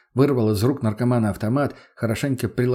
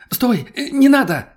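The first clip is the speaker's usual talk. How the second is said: loud, high-pitched plea to stop; sudden shout; 13 semitones higher, 6 dB louder than usual. A narrator's reading. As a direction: cry out in panic, right up high. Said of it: "Вдруг закричал он".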